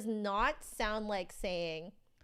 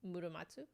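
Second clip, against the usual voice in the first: "Muramatsu" evenly weighted, softly, rising as a question; no syllable stress; 9 dB quieter, faster than usual. Shown as a demonstration. Checking with chat, soft unstressed "Muramatsu". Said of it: "Japanese doesn't stress accents".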